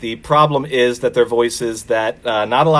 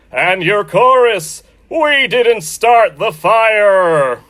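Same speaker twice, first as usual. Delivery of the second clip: much higher, louder, very slow booming announcer; Don Pardo announcer voice; 8 semitones higher, 4 dB louder, much slower than usual. Pretend. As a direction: stretch every name out like a game-show announcer. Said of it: "Don Pardo had to step in for him".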